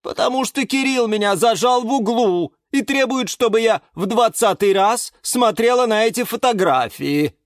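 exasperated orchid. A narrator's reading. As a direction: tell on Kirill, fed up. Galatea complains on.

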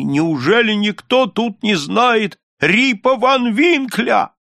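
high, drawn-out despairing plea; desperate cry. A narrator's reading. Rip begging the strangers to know him, desperate cry. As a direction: cry out for someone to recognize you. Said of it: "но крикнул в отчаянии".

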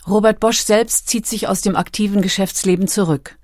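ordinary delivery

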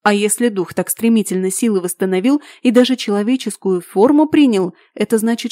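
ordinary delivery

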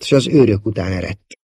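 ordinary delivery

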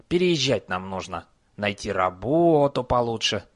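ordinary delivery, nothing marked

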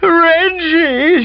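high, loud crying wail of "Reggie". A narrator's reading. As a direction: wail his name in distress. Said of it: "she wailed".

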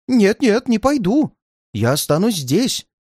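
high, agitated refusal; frightened old man digging in; alarmed exclamation. A narrator's reading. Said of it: "воскликнул незнакомец".

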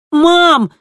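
high, loud cry; urgent interjection. A narrator's reading. Kristina breaking into the conversation, urgent shout. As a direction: cut in, desperate for Mom's attention. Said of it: "вмешалась Кристина".

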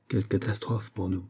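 soft, hushed regret; murmured lament. A narrator's reading. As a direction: murmur the dismay quietly. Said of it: "murmura le médecin". (consternation murmurée, à voix basse)

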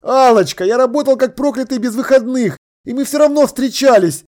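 exasperated self-defence; pleading protest. A narrator's reading. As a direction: argue back urgently. Man pleading in phone quarrel.

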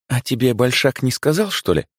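put-on friendly older woman voice; директриса, добрая. A narrator's reading. Director voice. (добродушная директриса)

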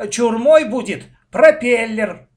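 loud, high, excited shout; boy's exuberant yell. A narrator's reading. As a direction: shout your claim, stretching it out. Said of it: "закричал Коля".

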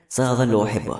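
ordinary delivery

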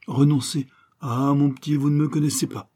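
high, loud boastful protest; indignant bravado. Acted. Detective refuses to give up the fight, indignant bravado.

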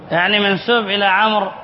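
ordinary delivery

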